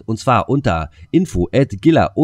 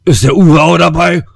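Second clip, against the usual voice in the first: loud, high, drawn-out holler; big raised party yell; mock shout; 7 semitones higher, 11 dB louder, slower than usual. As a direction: holler it out slowly like a rowdy caller. Mock shout after list of guesses.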